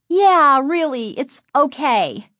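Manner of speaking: put-on high girl's voice, stretched at the end; eager agreement. A narrator's reading. Lindy backing up her sister to the driver, eager agreement. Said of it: "Lindy quickly agreed".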